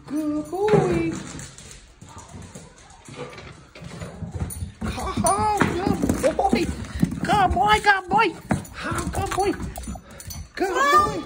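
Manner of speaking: very high squeaky dog praise; squeaky praising a dog. Real, not acted. Praising dog for finding shed.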